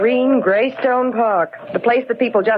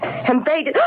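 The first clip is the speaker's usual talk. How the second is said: normal talking voice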